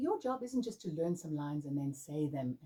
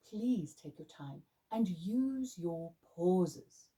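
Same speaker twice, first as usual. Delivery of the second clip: slow deliberate delivery; much slower than usual. Shown as a demonstration.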